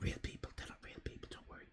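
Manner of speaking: soft secretive whisper. Real, not acted. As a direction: lean in and whisper it reassuringly. Whispered aside to chat.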